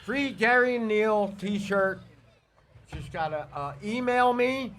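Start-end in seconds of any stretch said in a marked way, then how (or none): none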